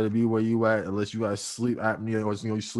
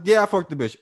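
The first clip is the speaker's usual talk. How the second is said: loud, high defiant confession; brazen admission; 7 semitones higher, 6 dB louder than usual. Retold or imitated. Boyfriend admitting cheating to girlfriend, loud and defiant. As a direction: throw it in her face. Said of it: "Or the".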